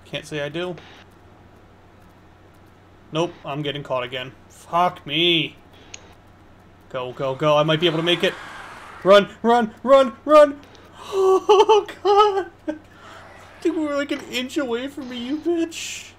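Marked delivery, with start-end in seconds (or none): 4.5-10.6 louder and higher, frantic yelling run; panicked escape, shouting run run; being chased. Real, not acted. 11.1-16.1 high, breathless laughing; laughing relief. Real, not acted. Relieved after close escape.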